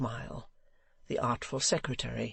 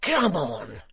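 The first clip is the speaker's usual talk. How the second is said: crying out; 5 dB louder than usual. Acted. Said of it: "cried Hugh".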